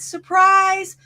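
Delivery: put-on spring the surprise on the parents like a kid; a boy's eager surprise shout, retold lightly; excited surprise. Boy surprising his parents.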